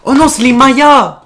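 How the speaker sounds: loud high dismayed cry; surprised shout. Real, not acted. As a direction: yell it out at the wrong answer. Loses the quiz, realizes.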